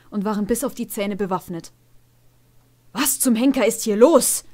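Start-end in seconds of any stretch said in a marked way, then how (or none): 2.9-4.4 louder startled demand; a touch alarmed, sharp; agitated snort. A narrator's reading. Old stallion confronts storming guards, agitated. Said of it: "schnaubte Grindor aufgeregt".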